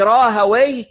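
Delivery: Rahawayh with a -wayh ending; Arabicized pronunciation. Shown as a demonstration. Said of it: "c'est la manière arabisée de son nom".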